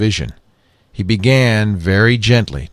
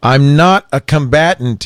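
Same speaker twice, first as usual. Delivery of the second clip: loud but steady; 7 semitones higher, 4 dB louder than usual. A narrator's reading. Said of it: "loudly but in a steady voice".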